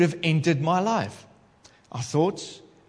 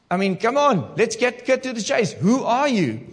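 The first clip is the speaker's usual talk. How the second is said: raised, louder exasperated demand; impatient; 8 semitones higher, 4 dB louder than usual. Real, not acted. Preacher demanding the spirit name itself, exasperated and louder. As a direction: cut to the chase, fed up. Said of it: "impatient demand".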